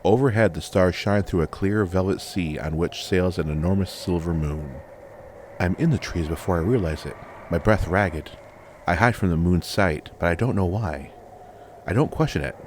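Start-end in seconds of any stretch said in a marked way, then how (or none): none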